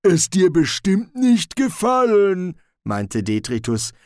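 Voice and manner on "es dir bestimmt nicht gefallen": loud, raised, simple troll voice; warning troll. Acted. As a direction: warn bluntly as a troll. Troll warns about the find. (warnender Troll, laut)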